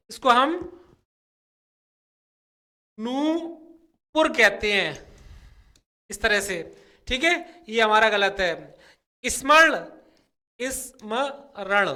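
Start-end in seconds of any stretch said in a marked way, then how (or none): 3.0-4.3 नूपुर said slowly and carefully, syllable by syllable; careful pronunciation. Shown as a demonstration. Teacher slowly models नूपुर for students, carefully. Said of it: "नूपुर नहीं कहते हैं इसको". 10.6-11.9 स्मरण drawn out very slowly, each syllable clear; slow careful pronunciation. Shown as a demonstration. Teacher demonstrates स्मरण to the class, slowly. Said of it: "इस तरह से कहा जाता है".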